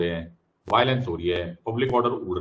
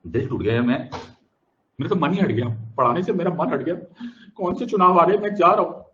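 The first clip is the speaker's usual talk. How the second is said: higher, louder hammed-up huff; mock despair; 5 semitones higher, 6 dB louder than usual. Teacher jokingly quitting teaching over a student, higher and louder. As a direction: pretend to storm off.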